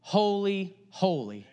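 mild reenacted angelic cry of worship; seraphim crying out. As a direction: lift the first two holys up, drop the third like an amen. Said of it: "They cried out".

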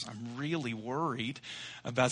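hushed and fretful, dragging the words; nervous bride to mom. Retold or imitated. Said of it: "She said".

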